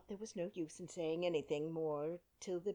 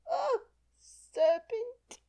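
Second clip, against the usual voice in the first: building to a high, loud, slow shriek; 10 semitones higher, 6 dB louder than usual. A narrator's reading. Pigeon shrieks at Alice. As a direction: wail "Oh, serpent!" at the top. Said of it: "raising its voice to a shriek".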